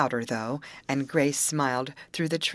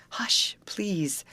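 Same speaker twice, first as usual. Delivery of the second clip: begging whisper; 5 semitones higher than usual. A narrator's reading. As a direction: gently hush a hot temper. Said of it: "begged gentle Amy".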